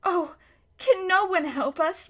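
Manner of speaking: high, mild plaintive wail. A narrator's reading. Lucy despairing to the Beavers, mild wail. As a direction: cry out in despair. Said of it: "wailed Lucy".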